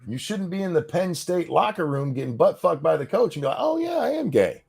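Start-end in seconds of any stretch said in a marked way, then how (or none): 3.6-4.6 very high-pitched mock epiphany; high sing-out mock voice; mock realization. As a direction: pitch it way up, parodying a discovery. Acted quote of sudden realization.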